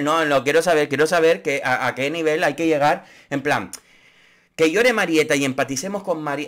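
usual speaking voice

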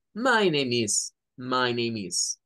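slow "My name is", twice; model phrase. Shown as a demonstration. Teacher repeats the phrase before students start. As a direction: drill it slowly. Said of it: "cada uno con esa expresión".

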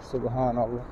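usual speaking voice

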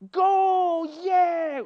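high, loud imitated cheer; mock market-stall cheer; cheering crowd. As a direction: shout it like the whole market cheering. Shop holders cheering a winner.

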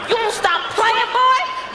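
mock threat. Wife threatens husband playfully.

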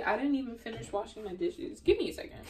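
ordinary talk, nothing marked

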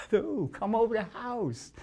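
high, amazed and giddy; giddy high voice, The Who invited him over; thrilled disbelief. Retold or imitated. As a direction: squeal inside with disbelief. Amazed inner voice, laughing.

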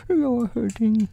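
small high-pitched storybook ow; crying out. A narrator's reading. Pooh being pulled by the paw, high pained cry. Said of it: "cried Boo".

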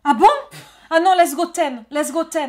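urgent push. Real, not acted. Rushing to start timed test.

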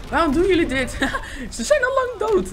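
high, incredulous voice breaking into laughter; helpless laughing exclamation; laughing protest. Real, not acted. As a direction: protest to your teammates while laughing. Laughing at teammates' needless bombing.

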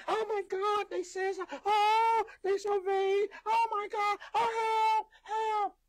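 high, loud mock wailing; hammed-up helpless cry for rescue; playing the victim. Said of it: "stupid people will always play victim".

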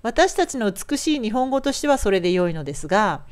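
ordinary delivery

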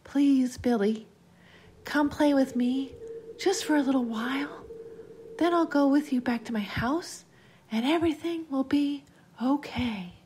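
put-on high, pleading little-girl voice; eerie playmate pleading; coaxing child voice. A narrator's reading. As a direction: coax him up high like a child. Ghostly child coaxing Billy.

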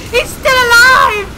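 very high, slow, loud yell; drawn-out cry of panic; alarmed scream. Real, not acted. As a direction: shriek it in disbelief. Alarmed boss still living.

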